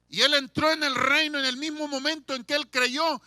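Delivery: high, loud preaching climb. Acted. Preacher proclaiming the thief entered paradise, fervent. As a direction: lift the congregation with it.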